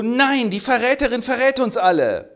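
high, loud shrill accusation; hammed-up hysteria; hysterical shout. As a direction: scream betrayal at them.

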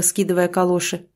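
usual speaking voice

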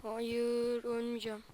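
very soft, hushed voice while hiding. Real, not acted. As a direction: keep it low so nobody hears. Hiding from enemies in game.

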